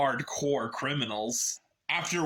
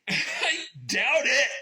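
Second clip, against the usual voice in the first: higher, louder, slow reply through a laugh; smug laughing retort; 7 semitones higher, 4 dB louder, slower than usual. Retold or imitated. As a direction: laugh off the threat. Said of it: "And you're like".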